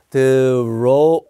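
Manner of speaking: "road" said slowly as ro-od, long o; road with an o sound. Shown as a demonstration. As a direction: stretch the o in "road". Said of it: "우예요. 오 발음이에요". (road를 '로우'가 아니라 '오' 소리로 길게)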